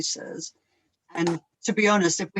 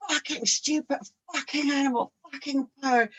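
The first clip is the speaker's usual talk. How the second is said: higher fed-up cursing; angry husband; 8 semitones higher than usual. Retold or imitated. Husband ranting at the goat, higher and fed up. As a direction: spit out each curse at the animal.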